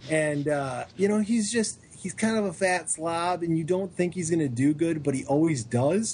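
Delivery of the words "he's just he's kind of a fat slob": ordinary delivery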